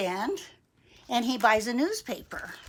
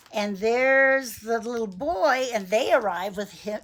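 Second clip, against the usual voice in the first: ordinary delivery, nothing marked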